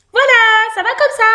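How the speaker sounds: very high, loud mouse squeal; obliging mouse. A narrator's reading. Mouse asking the bandit's approval, high and eager. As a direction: pipe up high, showing it off. Mouse shows raised paws.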